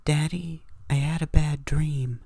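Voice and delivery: put-on high small child voice; little daughter. Little girl waking her daddy at night, small high voice.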